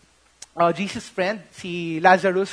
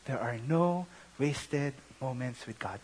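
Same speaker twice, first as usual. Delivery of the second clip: quiet conviction; 8 semitones lower, 11 dB quieter than usual. Real, not acted. Preacher hushed conviction.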